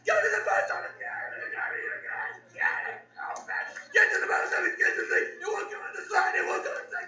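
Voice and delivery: very high, strained yelling; hammed-up frantic shouting; crazed letter writer. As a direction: screech like a worked-up old crank. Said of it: "You just gotta be like".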